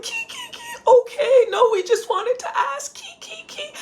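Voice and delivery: high squealing giggles; giggling fans. Retold or imitated. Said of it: "and they're like".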